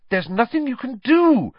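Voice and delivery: high, loud, urgent cry; stricken Hermione. A narrator's reading. Hermione stopping Harry, stricken and high. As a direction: blurt it out in alarm. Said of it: "looking stricken".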